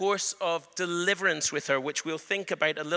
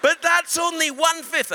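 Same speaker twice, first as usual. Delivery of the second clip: excited; 7 semitones higher, 5 dB louder than usual. Retold or imitated. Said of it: "And I was like".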